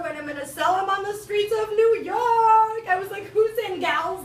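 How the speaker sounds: rally the friends like a hustler; excited pitch. Retold or imitated. Announcing scheme to friends, loud.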